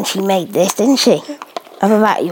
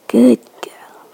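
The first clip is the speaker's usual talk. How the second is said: whispered praise; 2 semitones higher than usual. Real, not acted. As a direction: coo it under your breath. Whispered praise to feeding baby.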